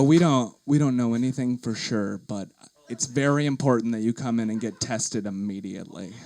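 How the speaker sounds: calm clerk urging a test; fake official voice. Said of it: "put on my best fake voice for her".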